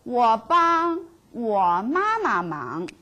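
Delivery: 我帮我爸爸忙 said slowly and evenly; slow model sentence. Shown as a demonstration. Teacher models the whole sentence slowly. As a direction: read 我帮我爸爸忙 slowly for the class. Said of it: "So how about my mother?".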